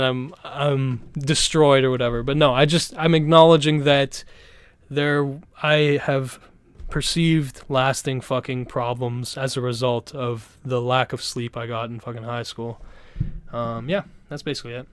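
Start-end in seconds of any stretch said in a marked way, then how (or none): none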